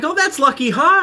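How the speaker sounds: high, bright-ish forced relief; high nervous cheer; mock cheerful relief. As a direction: pretend it's all fine. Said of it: "I'm like".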